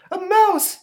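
put-on shrieking old lady; sudden high shriek; startled scream. Old lady ticket taker shrieking at a mouse. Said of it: "says the old lady".

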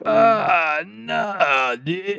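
high, fading groan of sympathy; pained on a friend's behalf; dismayed groan. Real, not acted. Dismayed reaction to only seven consoles.

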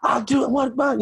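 high, mock-furious rant; screaming and yelling. Lifter yelling at his coach in the gym, high and ranting. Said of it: "screaming and yelling".

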